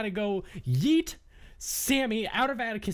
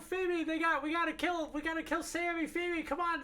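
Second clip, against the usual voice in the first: mock yelling; 8 semitones higher, faster than usual. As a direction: urgently call out to Phoebe, pretend shouting. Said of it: "Are you gonna yell, [name]?".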